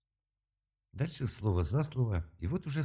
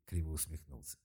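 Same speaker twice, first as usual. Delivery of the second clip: plain reading voice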